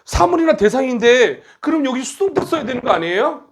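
high-pitched mock student protest; objecting student. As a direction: imitate a pupil pleading a grammar objection. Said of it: "그렇게 공부하지 말라고 그랬지".

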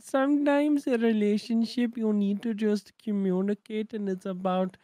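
put-on Indian-accented host giving earnest counsel; mock Indian accent. Tutorial host turns relationship adviser, mock Indian accent. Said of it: "He's giving relationship advice".

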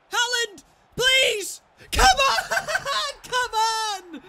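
very high, loud laughing shout; laughing cheer. Real, not acted. Gamer celebrating Haaland's hat trick, laughing shout. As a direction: scream it through a laugh.

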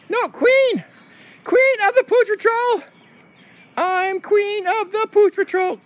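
high, insistent, slowing to a proclamation; indignant dog queen. Pretend. Dog queen corrects the captain, high and indignant.

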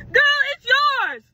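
high, loud, drawn-out retort; exasperated outburst. Acted. Calling out the friend who claimed it, exasperated. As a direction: cry out in mock disbelief, slow and loud.

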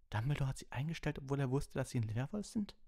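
high-pitched, incredulous question; gasped disbelief. A narrator's reading. Ron questioning Dumbledore hiring a werewolf, aghast. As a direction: blurt it out, aghast. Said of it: "schnappte Ron mit aufgerissenen Augen".

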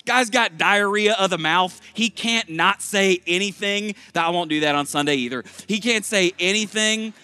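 high, loud, emphatic preacher; full-force preaching. Acted.

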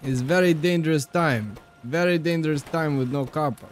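hammed-up Russian accent for game commentary. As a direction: play a bossy Russian builder, thick accent. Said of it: "in a Russian accent".

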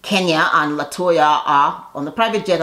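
usual speaking voice

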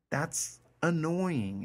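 drawn-out annoyed whine. Retold or imitated. Teacher voicing the reader's annoyance, drawn out. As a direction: stretch it out, fed up.

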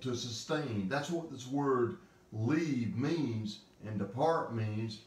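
usual speaking voice

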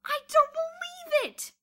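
very high, loud, drawn-out shout; delighted roar. A narrator's reading. Bruno hearing of the prize money, delighted roar. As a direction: roar it with delight over the din. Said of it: "Bruno roared delightedly over the din".

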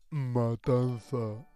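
palabra arrastrada, muy lenta; imitando la película. Retold or imitated. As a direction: imita la frase del doblaje alargando cada sílaba. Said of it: "dice, no sé qué, ahora estamos con". (imitación lenta y solemne)

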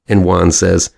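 normal talking voice

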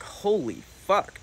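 drawn-out, high-pitched disbelief; floored, stretching the words out high; stunned reaction. Retold or imitated. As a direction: retell it as a slow, astonished exclamation. Retold shock at rehab count.

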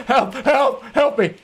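high, drawn-out plea for help; cry for help. Pretend. Mock cry for rescue in game.

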